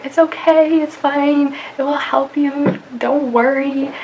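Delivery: lilting mock comforting voice; reassuring audiologist. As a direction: retell someone gently talking you into it. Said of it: "help me with being like".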